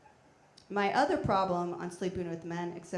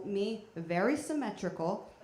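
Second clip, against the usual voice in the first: normal talking voice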